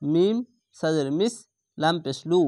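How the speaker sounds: ordinary talk, nothing marked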